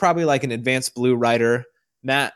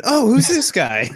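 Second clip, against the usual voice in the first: slow, high, louder mock British voice; mock British accent; 9 semitones higher, 4 dB louder than usual. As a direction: put on a hammed-up British accent. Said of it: "[name]'s British voice".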